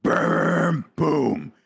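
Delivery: juddering mechanical rumble, then a boom; imitated elevator noise. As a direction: imitate a lift lurching to a halt. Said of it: "I hear it just go".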